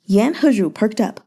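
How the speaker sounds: usual speaking voice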